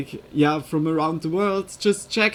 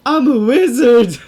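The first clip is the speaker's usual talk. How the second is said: wizard voice; 5 semitones higher, 11 dB louder than usual. Pretend. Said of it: "make a witch, witch voice sound".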